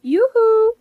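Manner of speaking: high, loud sing-song yoo-hoo; mother-in-law announcing herself with a cheery call. Real, not acted. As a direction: call out brightly to get her attention. Mother-in-law calling out on arrival.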